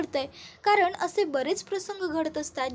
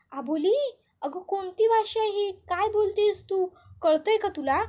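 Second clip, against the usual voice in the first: lightly voiced aunt, baffled and pressing; puzzled aunt questioning; 6 semitones higher than usual. A narrator's reading. Aunt demands answers from the girl, puzzled.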